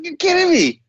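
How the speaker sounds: high, excited incredulous outburst; amazed disbelief. Retold or imitated. Retells his disbelief at temple's age.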